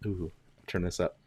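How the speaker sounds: ordinary talk, nothing marked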